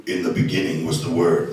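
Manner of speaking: deep, low masked-villain voice; Darth Vader. Acted. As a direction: intone scripture low, like a helmeted dark lord. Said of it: "like Darth Vader".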